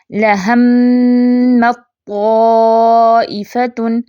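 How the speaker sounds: slow Quranic recitation with full idgham of ت into ط; tajweed recitation. Shown as a demonstration. Teacher demonstrates idgham to students, slow chanted recitation. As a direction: recite لَهَمَّتْ طَائِفَةٌ slowly, ت merged into ط. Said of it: "جیسے دیکھیں".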